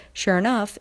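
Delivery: ordinary delivery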